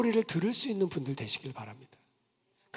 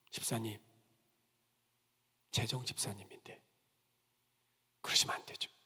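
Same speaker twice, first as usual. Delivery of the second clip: soft, hushed private warning; gentle whisper; 10 semitones lower, 9 dB quieter than usual. Retold or imitated. Pastor quietly warns the finance deacon, whispering. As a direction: whisper it so only she hears.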